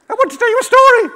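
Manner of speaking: very high, loud little squeak; small animal voice. Acted. Frog to a hungry shark, high loud squeal. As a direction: squeal urgently in a tiny voice. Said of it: "all my small animals have got the same voice".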